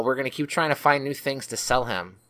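normal talking voice

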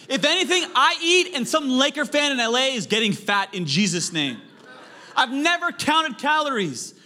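high, loud comic rant; excited shouting. Real, not acted. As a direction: belt it out like a punchline. Comic rant about eating.

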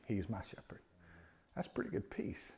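hushed, soft whisper. Real, not acted. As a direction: breathe it out gently. Whispered assurance after climax.

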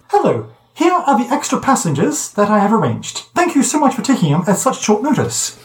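high, prim protocol droid; chirpy overly polite droid; lightly voiced prissy droid.

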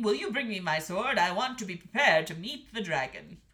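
loud, kingly request; calling king. A narrator's reading. King asking the queen for his sword, calling loudly. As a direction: call it out firmly to your wife. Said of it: "called the king".

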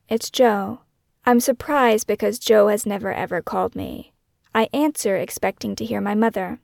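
normal talking voice

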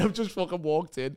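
normal talking voice

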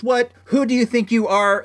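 retold challenge from an angry neighbor; imitating escalating anger. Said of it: "I can see he's getting mad".

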